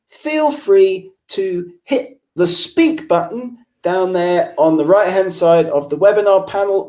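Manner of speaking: normal talking voice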